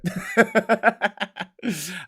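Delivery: high, loud burst of laughter; real laugh. Real, not acted. Streamer giving up on Welsh pronunciation, real laughter. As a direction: crack up at your own failure.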